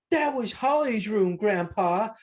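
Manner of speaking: high-pitched, acted scolding of grandpa; mock-exasperated child; boy's complaint. Acting out the boy scolding Grandpa.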